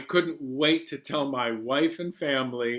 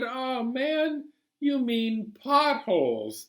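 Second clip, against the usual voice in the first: puzzled correction; 9 semitones higher, slower than usual. Acted. Narrator correcting passenger.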